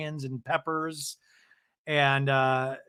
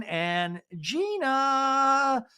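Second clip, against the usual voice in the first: drawn-out reveal; 10 semitones higher, much slower than usual. Acted. Host revealing the last arrival, slow and high. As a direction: stretch the name out slowly and high.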